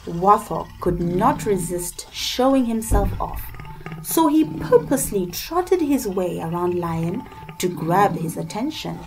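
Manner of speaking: ordinary delivery, nothing marked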